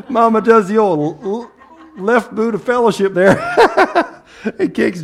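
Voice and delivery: laughing while talking. Real, not acted. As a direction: chuckle through the joke. Laughing at his own joke.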